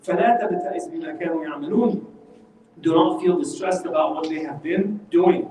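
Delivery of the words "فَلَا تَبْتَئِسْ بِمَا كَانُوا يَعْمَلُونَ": measured Arabic recitation; Quran recitation. Acted. Teacher quotes Yusuf's verse to the class, reciting.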